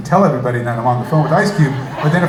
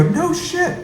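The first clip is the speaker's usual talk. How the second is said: surprised. Retold or imitated. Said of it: "manager reacts".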